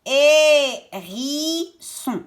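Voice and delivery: "Hérisson" very slowly as hé, ri, sson; syllable by syllable. Shown as a demonstration. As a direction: stretch each of the three syllables of "Hérisson". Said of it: "Trois syllabes". (« Hérisson » découpé lentement en trois syllabes)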